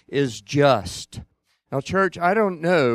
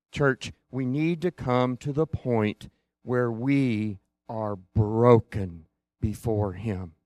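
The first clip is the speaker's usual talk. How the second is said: normal talking voice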